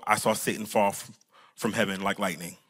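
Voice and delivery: very low deadpan Jesus; straight-faced. Pretend. Pastor imagines Jesus answering the excited disciples, straight-faced deadpan. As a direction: say it low and quiet, utterly unexcited. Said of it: "with a straight face".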